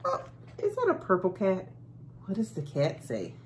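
ordinary delivery

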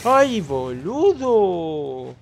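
loud, slow drawn-out scared wail; startled cry. Real, not acted. Gamer jumping at a scare, crying out. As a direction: let the fright stretch the words.

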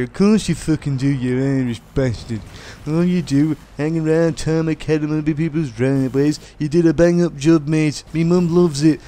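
mock English taunting. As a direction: jeer like an English lad having a go. Mimicking English abuse of Irish people.

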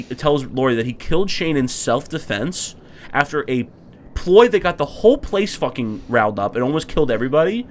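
ordinary delivery

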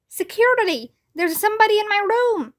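high-pitched, alarmed call for security; shouting. A narrator's reading. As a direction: raise the alarm in a storybook shout. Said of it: "I shouted".

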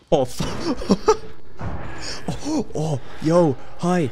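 high nervous laughing yelps; panicked giggle when spotted; scared horror game streamer. Real, not acted. Caught by enemy, nervous laugh.

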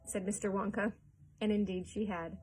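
ordinary delivery

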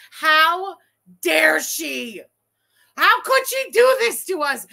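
loud, high indignant shout; outraged shout. Acted. Angry fan yelling at the streamer in outrage. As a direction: blast her for cutting the scene.

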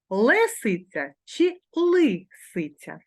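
зерно чи зирно said slowly, each vowel stressed to contrast е and и; slow spelling choice. Shown as a demonstration. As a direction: drag out зерно and зирно so both vowels are heard. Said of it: "Як же написати".

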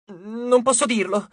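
slow, halting and high; stammering. A narrator's reading. Scared dwarf stammers his refusal to Jason, slow and halting. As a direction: stumble over the words. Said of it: "balbettò Passalo".

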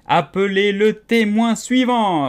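king ordering the court; royal command. A narrator's reading. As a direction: call the witness like a herald. King orders next witness.

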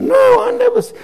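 very high, loud mock protest; protesting denial. Preacher denying he met the convert, protesting denial. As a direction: cut in with a baffled denial. Acted denial in mistaken-identity story.

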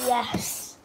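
ordinary talk

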